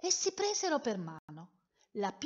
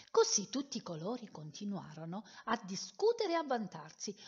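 plain reading voice

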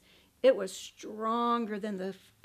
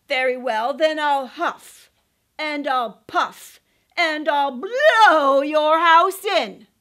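slow, loud growling wolf threat; werewolf; 2 semitones higher, 14 dB louder, slower than usual. Acted. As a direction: build to a roar.

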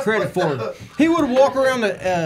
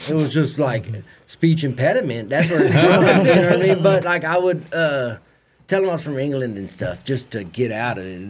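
ordinary delivery

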